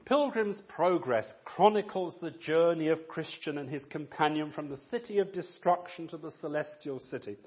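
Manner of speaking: ordinary talk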